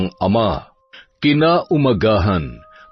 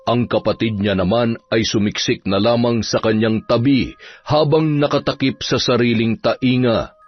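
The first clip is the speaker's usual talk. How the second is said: usual speaking voice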